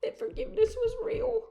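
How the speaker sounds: very high, choked-up voice; overcome with emotion. Real, not acted. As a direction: let the voice climb as emotion takes over. Moved recalling God's presence.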